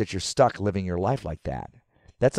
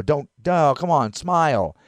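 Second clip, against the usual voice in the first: higher, louder, pushy coaxing; nagging control freak; 7 semitones higher, 6 dB louder, slower than usual. Retold or imitated. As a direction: nag them to smile. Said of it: "control freak issue".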